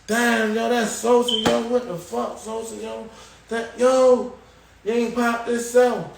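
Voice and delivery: high-pitched, worked-up disbelief; retold excited outcry. Inmates calling out about the locked cell, excited outcry. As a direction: pitch it up like you can't believe it.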